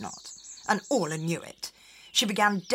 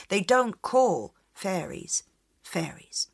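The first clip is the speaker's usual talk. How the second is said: plain reading voice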